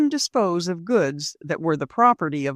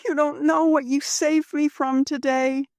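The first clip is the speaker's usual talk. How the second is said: Rose's voice; 9 semitones higher than usual. Acted. Rose voiced by narrator.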